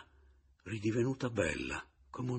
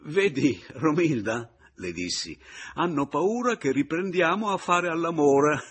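laughing tease; 8 semitones higher, 9 dB louder than usual. A narrator's reading. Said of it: "m'ero messo a ridere".

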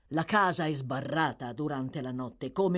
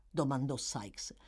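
ordinary delivery

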